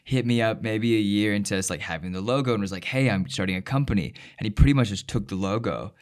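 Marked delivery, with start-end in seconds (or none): none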